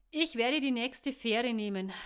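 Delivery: loud parting call. A narrator's reading. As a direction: call it back to her as he leaves. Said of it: "rief er ihr zu und ging".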